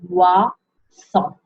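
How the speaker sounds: "Croissant" with a drawn-out throaty French R, then a pause before the last syllable; French R stressed. Shown as a demonstration. Teacher drilling the R in croissant, exaggerated French R. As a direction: stretch the R in "Croissant" and split it, krrwa-san. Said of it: "make sure you say your R".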